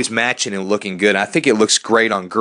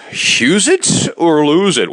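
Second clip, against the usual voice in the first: slow, high slogan callout; announcer slogan; 6 semitones higher, 4 dB louder, slower than usual. Acted. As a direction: announce the show's catchphrase.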